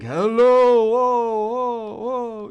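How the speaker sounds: loud, high booming whoas; booming giant call. Retold or imitated. Teacher as the giant access point, booming loudly. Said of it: "the access point is the Jolly Green Giant".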